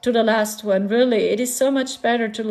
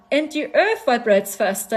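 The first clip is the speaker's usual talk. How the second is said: ordinary talk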